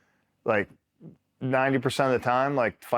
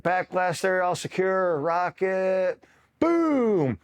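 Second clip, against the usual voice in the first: hammed-up rocket call; 8 semitones higher, slower than usual. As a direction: call out the drill like an eager recruit, then yell boom. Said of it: "And they're like".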